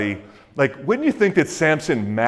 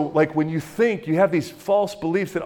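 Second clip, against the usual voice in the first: ordinary delivery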